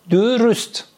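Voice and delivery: rounded front ü twice in درست; Turkish dürüst. Shown as a demonstration. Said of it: "تلفظ کنید".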